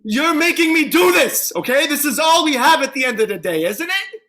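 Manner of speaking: loud and high, pleading and threatening at once; desperate shout. Acted. Man with a gun yelling at the cop, desperate. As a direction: yell it with the gun in your hand.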